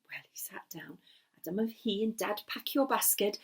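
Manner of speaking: ordinary delivery, nothing marked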